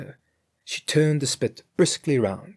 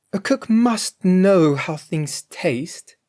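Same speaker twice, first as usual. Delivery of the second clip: Grethel's voice; 8 semitones higher, 5 dB louder, slower than usual. A narrator's reading.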